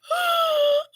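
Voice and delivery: high sharp gasp of horror; shocked gasp. Retold or imitated. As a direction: gasp as if caught out. Friend's mortified reaction.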